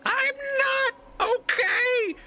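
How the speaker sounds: very high, slow, wailing goblin cry; goblin yelling. Acted. The goblin Snotchgrin wails down from the mast, shrill and crying. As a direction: shriek it in a tiny creature's panic. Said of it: "Snotchgrin yelling".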